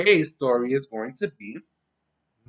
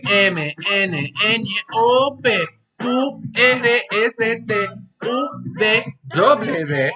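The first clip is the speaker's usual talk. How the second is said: kindergarten teacher voice; 6 semitones higher, 8 dB louder, slower than usual. Real, not acted. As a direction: cheer the class through it. Leading class chant loudly.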